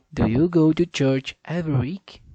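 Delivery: loud, deliberate speech for the app; practicing a sentence for the pronunciation app, loud and deliberate; loud careful repeat. Shown as a demonstration. Saying it loud for the machine to catch. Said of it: "Pra ver se essa máquina pega".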